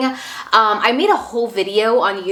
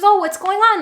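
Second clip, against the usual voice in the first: friend asking gently; 8 semitones higher than usual. Retold or imitated. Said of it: "And she goes".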